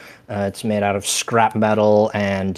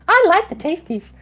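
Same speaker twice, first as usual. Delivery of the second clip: very high squeaky bickering head; two bickering giant voices; 4 dB louder than usual. Acted. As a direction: squeak it out, insisting like a greedy monster. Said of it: "they're bickering back and forth".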